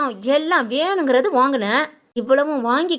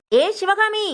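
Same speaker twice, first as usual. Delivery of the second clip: calling Sivakami out of the house, higher; 7 semitones higher, much slower than usual. Acted. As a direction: call out "ஏ Sivakami" higher. Calling her name from outside.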